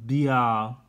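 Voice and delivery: "bia" said loudly as a mock misreading; "bia" as the wrong guess; wrong reading. Shown as a demonstration. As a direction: sound out "bia" loud and clear. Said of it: "You'd think it would be".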